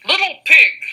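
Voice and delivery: loud, high call; wolf calling out. Acted. Wolf calling the pig to the turnip field, loud. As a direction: call out sweetly to lure the pig. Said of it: "called the wolf".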